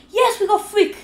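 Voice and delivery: excited cheer. Real, not acted. Success cheer.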